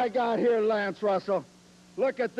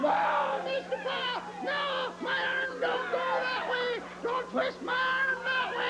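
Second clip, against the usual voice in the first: much higher squeaky pleading, climbing to mock screams; hammed-up high puppet squeals of pain; Mister Bill doll voice pleading; 12 semitones higher than usual. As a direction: beg like a tiny doll being wrestled. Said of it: "Well, Mister Bill, do you know what".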